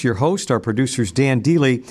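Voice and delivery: ordinary delivery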